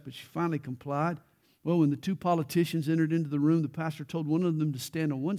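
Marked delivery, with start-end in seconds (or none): none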